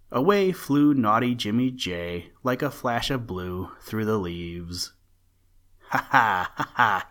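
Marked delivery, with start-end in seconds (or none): 5.9-7.1 loud teasing ha ha from outside; mocking laugh. A narrator's reading. The bird boy mocking the old rabbit from the window, laughing loud. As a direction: tease him with a cheeky laugh. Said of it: "he laughed".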